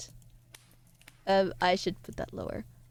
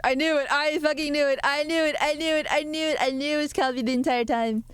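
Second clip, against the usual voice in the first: raised pitch, repeated in excited vindication; triumphant outburst; 5 semitones higher than usual. Real, not acted. Streamer catching out a prankster, triumphant and high. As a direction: exclaim it higher, over and over.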